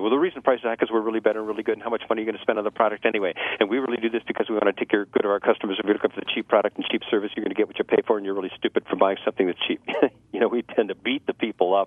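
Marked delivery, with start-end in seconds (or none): 0.0-9.8 fast, defensive over-explaining; pushy sales rant. Retold or imitated. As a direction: rush it like a salesman steamrolling. Pushy salesman beating customer up.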